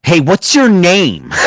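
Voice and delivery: drawn-out, shouted pick-up question; mock calling out. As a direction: belt it out slowly at a stranger. Acts out calling to the girl.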